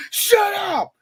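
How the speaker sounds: high, loud cut-off cry; mock outraged yell. Bigot refusing to hear about mixed heritage, mock outraged yell. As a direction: shout down the speaker in mock outrage. Acted bigot silencing talk of mixing.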